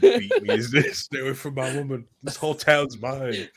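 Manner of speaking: can barely get it out for laughing; giggling mock threat. Real, not acted. Joking while laughing hard.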